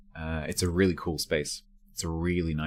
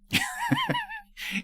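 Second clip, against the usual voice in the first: high falling giggle; high laugh. Real, not acted. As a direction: let a squeaky laugh fall away. Laughing at own story.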